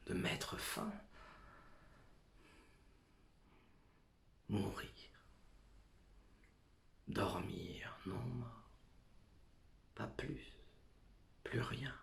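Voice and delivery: soft breathy whisper, fading away; hushed whisper. Acted. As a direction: let the words die out in a whisper. Soliloquy on death, fading to whisper.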